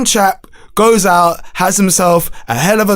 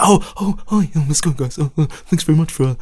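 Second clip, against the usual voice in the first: mock [name]; 5 semitones lower, 5 dB quieter, faster than usual. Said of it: "He gives off that".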